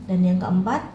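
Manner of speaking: ordinary talk, nothing marked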